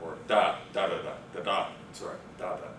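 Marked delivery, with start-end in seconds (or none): 0.3-1.7 lower-pitched "da da da", accent landing on the first "da" of each group; first-beat stress. Shown as a demonstration. Lecturer demonstrating stress on strings of any length, first-beat stress. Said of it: "always on the first". 2.4-2.7 soft "da da", weight on the first "da"; a low, quiet "da da" with the first one accented; first-beat stress. Shown as a demonstration. Lecturer correcting the stress demo, first-beat stress. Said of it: "always on the first".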